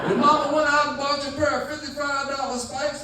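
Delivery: hammed-up dad heckling his kid at football. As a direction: jeer at your son from the stands.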